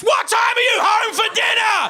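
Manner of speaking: high-pitched, full-throated yell; mum's urgent shriek, mimicked; all-caps mum. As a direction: shout it like a text in all caps. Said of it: "accidentally set her text messages to all caps".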